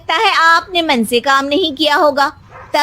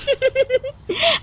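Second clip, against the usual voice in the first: laugh; 9 semitones higher, 6 dB quieter than usual. Acted. Sarcastic laugh at husband.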